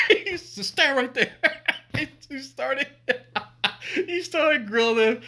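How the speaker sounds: high words broken up by real laughter; cracking up at the memory; laughing. Real, not acted. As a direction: tell it helplessly through the laughs. Laughs retelling the grilling.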